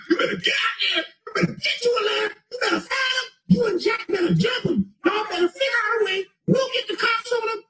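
high, shouted mock boss voice egging him on. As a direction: squeal it like a hyped-up boss pushing for a fight. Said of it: "Your boss is telling me".

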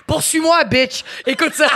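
high laughing dare, shouted at the end; laughing taunt. Real, not acted. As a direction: tease him through the laughter. Rit en taquinant Julien. (défi lancé en riant fort)

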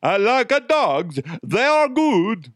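put-on high-pitched, botched Arkansas accent; bad Arkansas accent. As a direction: badly fake Arkansas, high and stretched out. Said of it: "that's a really bad Arkansas accent".

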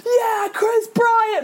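cheering. Retold or imitated. Cheering a home run among Phillies fans, high and drawn out.

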